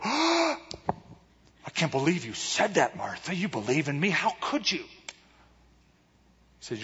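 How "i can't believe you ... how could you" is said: big gasp, then hammed-up disbelief; mock shock. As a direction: pretend to be scandalized and hurt. Acting out shocked rebuke Jesus didn't give.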